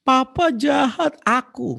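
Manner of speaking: very high, slow mock whine; hammed-up teen whining at papa; crying child. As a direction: wail it like a sulking kid. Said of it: "Giliran papa masih marah".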